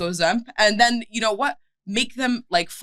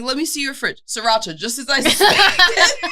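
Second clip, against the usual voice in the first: mock detective; 7 semitones higher, 6 dB louder than usual. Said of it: "mock detective".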